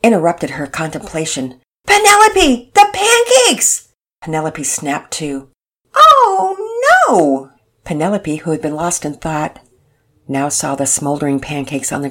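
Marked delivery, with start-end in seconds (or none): 1.9-3.9 high, loud urgent call across the kitchen. Acted. Mother calls Penelope from across the kitchen, loud and high. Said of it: "A loud voice". 5.9-7.5 sudden high yelp of dismay; dismayed cry. Acted. Penelope spots the burnt pancakes, high startled cry.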